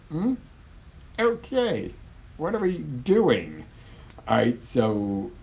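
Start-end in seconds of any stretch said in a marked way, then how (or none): 0.1-3.6 much higher, slow bemused question; puzzled wife. Pretend. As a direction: play the baffled wife, lightly. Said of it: "your wife's probably gonna look at you and go".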